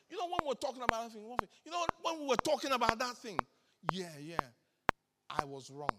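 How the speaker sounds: sheepish apology. Retold or imitated. Bishop mumbles his own apology.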